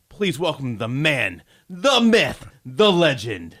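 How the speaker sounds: rising to a big announcer call on the myth; grand build-up introduction. Acted. Host hyping the guest's entrance, building to a big announcer call. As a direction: hype him up like a ring announcer.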